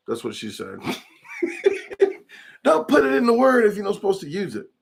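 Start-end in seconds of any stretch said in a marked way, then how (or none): 1.3-2.2 squealing giggle, much higher; high squeaky laugh. Real, not acted. Cracking up at his own joke, squeaky giggle. After his "that's what she said. 2.6-4.7 much higher, fast, exasperated; exasperated high rant. Real, not acted. Ranting to viewers about silent letters, high and fast.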